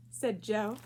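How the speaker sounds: ordinary delivery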